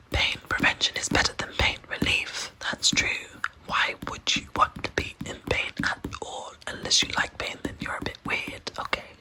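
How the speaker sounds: usual speaking voice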